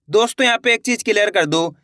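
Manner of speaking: ordinary talk, nothing marked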